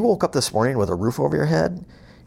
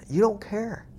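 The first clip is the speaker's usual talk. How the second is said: mocking; 9 semitones higher, slower than usual. Mocking indifferent workers.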